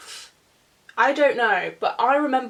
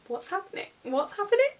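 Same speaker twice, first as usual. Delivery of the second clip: high, small-voiced questioning; bewildered mock panic; 9 semitones higher, 3 dB quieter, faster than usual. Date asking herself what is going on, high mock panic. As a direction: squeak it out in confusion. Retold panicked reaction, awkward park encounter.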